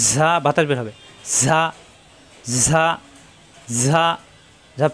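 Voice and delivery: ঝা with a long buzzing z that lets air out; whistling letter. Shown as a demonstration. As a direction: push air through the z of ঝা. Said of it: "সফির হরফ". (ঝা, বাতাস বের করে শিসের মতো)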